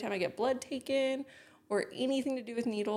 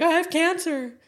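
high, loud mock wail; imitated crying outburst; 9 semitones higher, 8 dB louder than usual. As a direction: blurt it out as if in tears, pitched way up. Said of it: "very rarely heard me cry".

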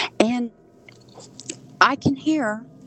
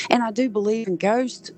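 ordinary talk

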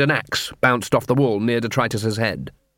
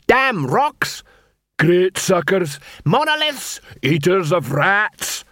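high-pitched dwarf abuse, drawn out at the end; angry insults; 7 semitones higher, much slower than usual. Acted. Dwarf insults the trolls across the street, angry. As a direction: spit out each insult high and indignant.

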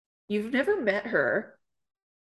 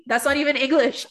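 usual speaking voice

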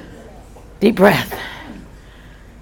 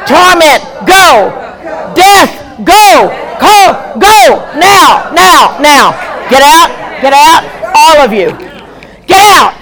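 rising, shouted commands, each spirit named then ordered out; preaching at full force; 3 semitones higher than usual. Acted. Pastor commanding demons to leave the congregation, forceful preaching shout.

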